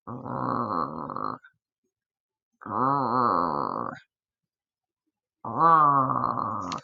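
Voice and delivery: deep animal growl, drawn out; low rumbling snarl. A narrator's reading. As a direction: growl like a swamp cat in the dark. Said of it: "That's the scariest snarl I ever heard".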